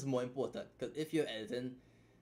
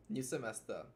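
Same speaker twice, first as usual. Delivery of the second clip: ordinary delivery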